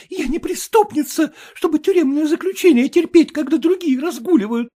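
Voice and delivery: high, loud, tearful wife; young wife. Acted. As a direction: complain like an upset young bride. Wife's voice.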